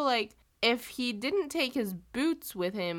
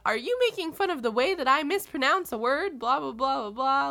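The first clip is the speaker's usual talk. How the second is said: higher, louder mock indignation; offended admiral; 5 semitones higher, 5 dB louder than usual. Admiral asks if he's being mocked, indignant imitation. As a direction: imitate an affronted officer, tailing into blahs. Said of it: "the admiral's like".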